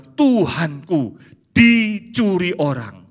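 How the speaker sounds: very slow, drawn-out despair; a lightly voiced stricken woman; stricken Maria. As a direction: mourn the theft. Maria imagines theft.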